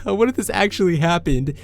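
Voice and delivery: giggly friend. Retold or imitated.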